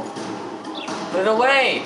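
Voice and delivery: drawn-out furious demand, each word stretched; angry yell. Real, not acted. Gamer yelling at his stubborn character, stretched angry demand. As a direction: plead and yell at once, dragging it out.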